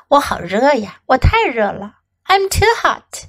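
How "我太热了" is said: ordinary delivery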